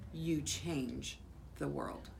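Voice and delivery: ordinary delivery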